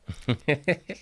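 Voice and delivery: high breathy giggle; a flamboyant little giggle; giggly laugh. Real, not acted. Laughing at himself on the podcast, high giggle. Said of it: "the gayest laugh I've ever done".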